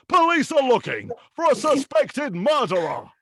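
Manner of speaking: high, loud booming news read; announcer as newsreader. Acted. TV announcer reading morning radio news, absurdly booming. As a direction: read grim news like a talent-show introduction. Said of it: "he started off as a newsreader".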